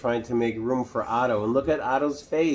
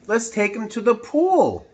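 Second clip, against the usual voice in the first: much higher, louder eager call; fireman's idea; 11 semitones higher, 4 dB louder than usual. Acted. Fireman suggesting the pool, high and eager. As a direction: announce the plan brightly. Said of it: "said the fireman".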